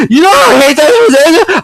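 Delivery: hammed-up sob; whiny fake crying; mock whine.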